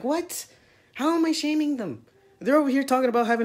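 high, loud outraged question; indignant disbelief. Real, not acted. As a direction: throw it back in disbelief.

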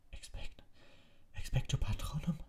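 broken, whispered spell; barely able to speak; faltering whisper. A narrator's reading. Harry alone and weakening, whispering the spell.

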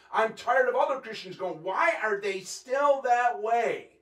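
loud, high, forceful sermon delivery; fired-up preacher in full flow; impassioned preaching. Real, not acted. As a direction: preach it hard, driving each phrase home.

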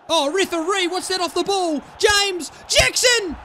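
high-pitched appeal building to a shout; indignant calling out. Real, not acted. Appealing to referee, then player.

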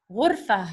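ghurfa closing on a breathed h; silent ending. Shown as a demonstration. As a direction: say ghurfa without "tun", just a puff of h at the end. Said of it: "it's silent".

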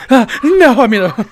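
hammed-up flustered stammer; mocking flustered voice. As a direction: imitate a nervous guy caught off guard, laughing. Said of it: "Listen how he changed up his voice".

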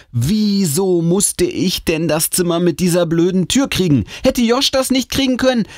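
hoch jammernd klagen; genervte Klage. Acted. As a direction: sich mit hoher Stimme über Ungerechtigkeit beschweren. Genervte Klage über die blöde Tür. (genervt jammern)

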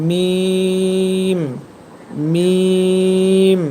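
মিম held long from the dry part of the lips; Arabic letter demo. Shown as a demonstration. Teacher drilling মিম for students. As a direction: hum মিম slowly. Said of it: "শুকনা অংশ থেকে মিম হরফটি".

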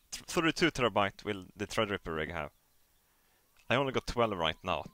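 normal talking voice

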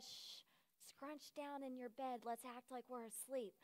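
very soft, hushed secret; urgent hush. Retold or imitated. Camper telling cousin to fake sleep, hushed. Hushing cousin to avoid being caught.

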